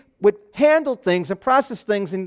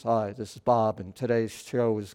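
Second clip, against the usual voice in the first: low, soft radio host intro; deep radio voice; 10 semitones lower, 10 dB quieter than usual. Acted. Preacher parodying the good radio voices he lacks, deep radio voice. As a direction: drop down into a deep announcer voice. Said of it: "those deep voices".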